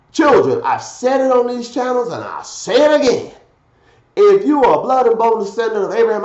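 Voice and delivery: emphatic sermon delivery, key words punched; forceful preaching. Real, not acted. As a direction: preach it loud and rising, scripture and commentary.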